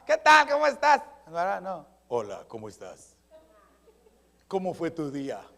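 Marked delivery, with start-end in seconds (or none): 0.0-1.0 loud, high mock greeting; wrong courting voice. Suitor wrongly greeting his sweetheart, comically loud. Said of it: "Usted no le hablaba". 2.1-5.4 low, suave announcer tone; radio announcer voice. Acted. Suitor courting his future wife, announcer voice. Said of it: "le habla con voz de locutor".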